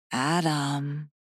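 soft, high calling of a name; possessed voice. A narrator's reading. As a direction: quietly summon attention as another being. Possessed woman addresses him again.

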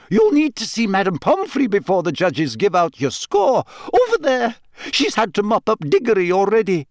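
high-pitched professor giving orders; lightly voiced, high schoolmistress; urgent professor. A narrator's reading. Professor sending Harry to see Madame Pomfrey, urgent. McGonagall sends injured Harry off.

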